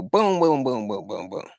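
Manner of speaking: mouth-made boom boom gunshot sounds; imitated gunshots. Retelling the officer shooting her, imitated gunshot booms. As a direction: spit out the shots like a kid's gun noise.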